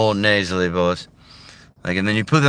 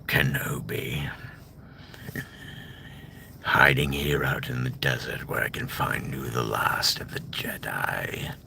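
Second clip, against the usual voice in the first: Darth Maul impression; 5 semitones lower, 8 dB quieter than usual. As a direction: hiss it out like Maul hunting. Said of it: "Sam Witwer does a bunch of voices".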